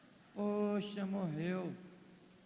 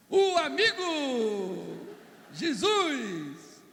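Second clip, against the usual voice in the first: mock wailing; 3 semitones higher, 5 dB louder, much slower than usual. Announcing the friend has come.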